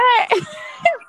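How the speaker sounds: high excited whoop laugh; whooping laugh shout. Real, not acted. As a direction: let out a loud whooping laugh. Whooping before guest reveal.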